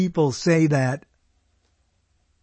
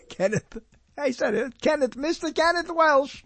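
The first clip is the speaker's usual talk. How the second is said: calling out; 11 semitones higher than usual. Acted. Calling for absent guest.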